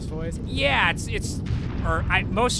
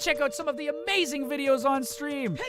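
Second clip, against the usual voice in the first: YouTuber outro voice; 8 semitones higher than usual. Shown as a demonstration. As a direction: gush like a YouTuber begging for clicks. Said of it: "I could still immediately go into the improving, uh, outro, like, end slate voice".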